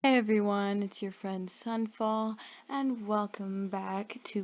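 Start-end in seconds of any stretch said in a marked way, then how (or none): none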